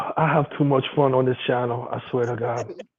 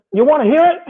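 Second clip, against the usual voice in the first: eager offer; 12 semitones higher, 6 dB louder, faster than usual. Retold or imitated. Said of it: "I said".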